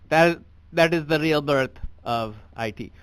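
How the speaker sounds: put-on comic thick Indian accent; stand-up bit done in a heavy Indian accent. Comedian on stage doing his act in an Indian accent. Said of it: "I gotta drop the accent".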